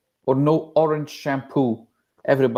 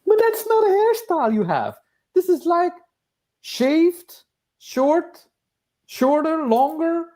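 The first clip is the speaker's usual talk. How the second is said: high voice; 12 semitones higher than usual. Acted. Commentator reacting to the haircut.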